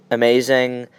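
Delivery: normal talking voice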